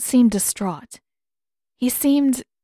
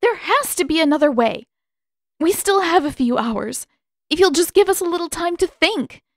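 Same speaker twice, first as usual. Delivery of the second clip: desperate pleading; 8 semitones higher, 4 dB louder than usual. Acted. Katara begs Pakku for time.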